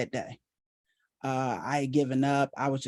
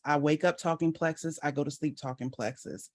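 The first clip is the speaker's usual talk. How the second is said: ordinary talk, nothing marked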